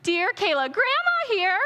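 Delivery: put-on high sweet old grandma reading her comment. Speaker mimics grandma's Facebook comment, high and sweet. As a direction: play the doting grandma signing her post. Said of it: "They do this".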